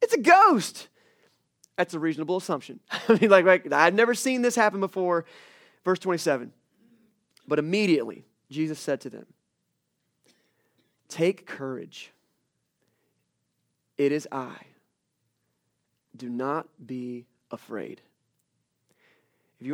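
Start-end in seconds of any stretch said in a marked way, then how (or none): 0.0-0.9 high, loud mock-terrified shout; acted panic, pitch leaping up; terrified cry. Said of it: "they are terrified". 11.1-18.0 low, slow, measured words of comfort; solemn, soothing scripture quote; calm reassurance. Acted. Preacher quotes Jesus calming the disciples.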